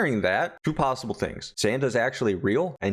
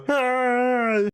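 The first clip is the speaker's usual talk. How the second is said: high, loud hammed-up scream; cartoon pain scream; mock scream; 14 semitones higher, 4 dB louder than usual. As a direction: yelp like a slashed villain. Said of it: "type of scream".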